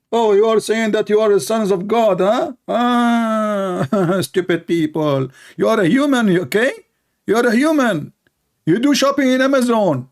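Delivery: high mocking impression of an opponent; lightly voiced mock debater taunting Christians. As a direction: scoff at them as the opponent would. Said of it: "He throw words".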